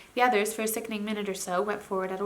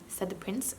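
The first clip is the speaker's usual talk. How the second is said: usual speaking voice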